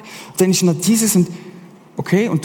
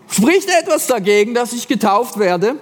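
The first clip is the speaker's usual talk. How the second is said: eager Ethiopian; 7 semitones higher than usual. Retold or imitated. Ethiopian asks for baptism.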